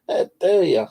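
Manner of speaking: high, loud indignant scold; mock outrage. Owner scolds her cat, mock indignant. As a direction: act offended at the cat.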